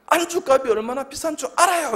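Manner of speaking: high, loud imitated telling-off; mock scolding. Colleague scolds the preacher over snack prices, mock outrage. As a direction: scold in an exaggerated high pitch.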